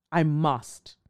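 ordinary delivery

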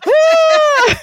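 high, loud mock scream; theatrical "ah", held high and loud; imitated dramatic scream. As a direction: let out a hammed-up, high shriek. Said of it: "laugh, he just, or scream".

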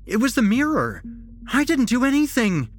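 high, loud, insistent whine; whining protest. A narrator's reading. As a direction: wail your innocence. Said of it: "Jesse whined".

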